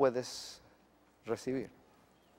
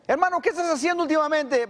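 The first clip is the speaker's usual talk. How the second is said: high, loud, fast chirpy hello; cheerful greeting; 11 semitones higher, 3 dB louder, faster than usual. Acted. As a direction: greet a fellow believer brightly. Acts cheerful brother greeting.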